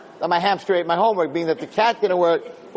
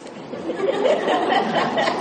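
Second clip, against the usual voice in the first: nervous laugh. Retold or imitated. Embarrassed kid in front of teacher.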